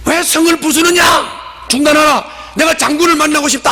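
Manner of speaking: high, forceful shouted demand; 여인의 외침. Acted. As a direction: cry out a challenge to a general. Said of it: "하고 소리를 질렀어요". (높고 힘찬 고함)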